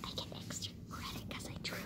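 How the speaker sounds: very soft whispered aside; hushed, barely audible whisper. Real, not acted. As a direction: whisper it like a secret. Secret aside about own drawing.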